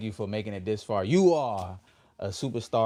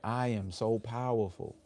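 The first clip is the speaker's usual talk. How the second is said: slow affirmation; slower than usual. Shown as a demonstration. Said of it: "Let's just affirm that".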